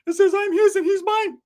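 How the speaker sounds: high, loud giddy falsetto; Ricky's excited voice. Retold or imitated. Ricky showing off his shirt in a high, loud falsetto. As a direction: squeal it like a smitten friend. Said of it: "Ricky was all infatuated".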